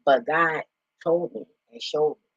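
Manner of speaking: normal talking voice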